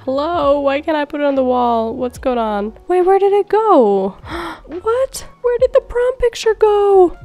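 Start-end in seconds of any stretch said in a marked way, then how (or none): 2.9-7.2 high, rising cries of disbelief; shocked dismay. Real, not acted. Gamer finding the prom picture gone, shocked. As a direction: sound like the picture just vanished before your eyes.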